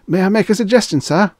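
very high, loud, pleading request; desperate. A narrator's reading. Clerk hurrying after departing customer, desperate. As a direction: call after him, voice leaping up. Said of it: "In desperation, he hurried after Gardner".

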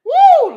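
high, loud "woo"; loud whoop. Real, not acted. Host reacting to her own bold statement, whooping. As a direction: let out a sudden high whoop. Exclaims before continuing her controversial point.

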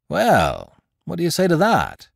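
a touch of playful triumph; lightly voiced cocky Lupin. A narrator's reading. Lupin springs his disguise on Beautrelet, playful triumph. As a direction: tease your rival with a light gloat.

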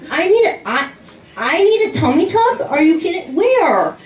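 high-pitched offended squeak; indignant disbelief. Retold or imitated. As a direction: daughter offended by tummy tuck idea, high. Indignant reply to mom.

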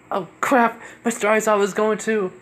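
put-on high-pitched panicky girl; acted alarm, voice shooting up; panicked. Girl realizes she will be late, high-pitched panic.

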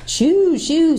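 high hooting shoo; sleepy owl. A narrator's reading. The owl shooing Jill back to sleep, high hoot. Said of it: "said a sleepy voice".

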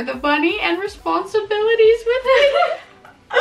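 high, slow laugh breaking through the reading. Real, not acted. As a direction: laugh out the last words of the letter. Laughs reading old letter.

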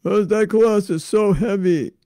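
high, loud, hammed-up whine; mock crying. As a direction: pretend to be crying and complain about it. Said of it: "Why are you crying?".

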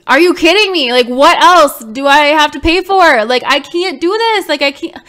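real frustrated cry; exasperated outburst. Real, not acted. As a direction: throw up your hands, fed up. Exasperated venting about mounting bills.

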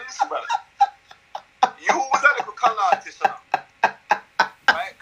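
Chris Eubank voice. Retold or imitated. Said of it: "I'm saying".

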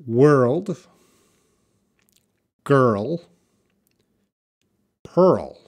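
put-on WORLD, GIRL, PEARL each said loud and clear, alone; separate, emphatic words: "World", "girl", "pearl"; pronunciation demo. Shown as a demonstration. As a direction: model "World", "girl", "pearl" with a strong American R. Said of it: "how to pronounce these words".

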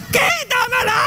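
high, shrill-free panicked shriek for help; panicked scream. Retold or imitated. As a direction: scream like a terrified man. Man screaming over salamander.

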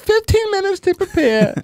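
very high, pleased with himself; high proud voice. Acted. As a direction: squeak it out proudly.

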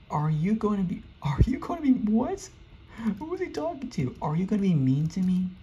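high-pitched, incredulous questioning; baffled questioning. Real, not acted. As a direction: pitch it way up in puzzled disbelief. Baffled by a chat message.

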